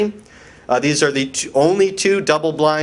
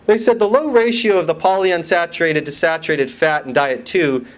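ordinary talk

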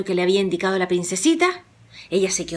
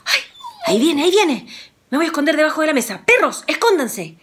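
higher, fast hurried alarm; panicked hiding; 7 semitones higher, faster than usual. Acted. Juan hurries to hide from the giant, fast and alarmed. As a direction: rush to hide before the giant comes.

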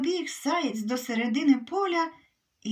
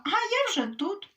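higher playful call; mild shout; 8 semitones higher than usual. Acted. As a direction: tease the hare with a light call. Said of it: "їжачиха йому і крикнула". (легкий вигук їжачихи)

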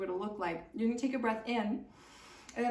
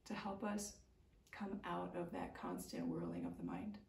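calm yoga teacher guiding the breath; guided meditation; 9 dB quieter than usual. Acted. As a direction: ease them out of the practice gently.

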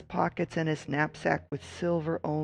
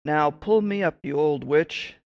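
loud call from below; 7 dB louder than usual. Acted. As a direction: shout up out of the hole. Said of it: "called up through the tree".

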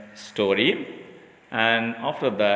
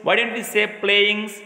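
teacher quizzing the class; 8 semitones higher than usual. Shown as a demonstration. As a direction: quiz young pupils with rising questions. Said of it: "I will ask the children".

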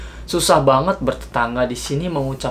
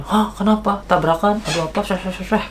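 startled wife; 5 semitones higher than usual. Retold or imitated.

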